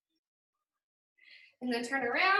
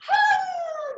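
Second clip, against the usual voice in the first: relieved gasp; 5 dB louder than usual. Real, not acted. Relieved cry at workout end.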